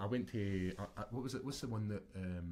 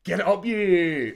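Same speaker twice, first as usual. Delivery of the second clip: loud, slow yell, much higher; corner shout; 9 semitones higher, 14 dB louder, much slower than usual. Retold or imitated. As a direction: roar at the fighter from the cage side. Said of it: "he said something like".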